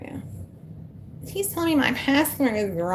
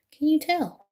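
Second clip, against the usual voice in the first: higher, wry echo of the question; playful higher echo; higher teasing repeat; 8 semitones higher than usual. Shown as a demonstration. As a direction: tease it back at them.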